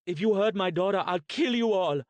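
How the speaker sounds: loud, high, fierce shouting; furious threat. Acted. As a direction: threaten them all to protect the child. Mother threatening her daughter's captors.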